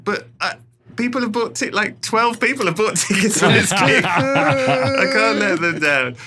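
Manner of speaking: high, loud comic pleading; pleading protest. Retold or imitated. Comedian defending his small gig to a colleague, pleading protest. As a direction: protest earnestly, laughter creeping in.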